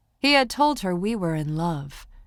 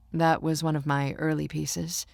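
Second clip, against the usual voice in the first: ordinary delivery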